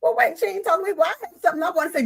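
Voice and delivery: imitating someone in the crowd, high and hurried; mock eager audience member. Which makes other people say.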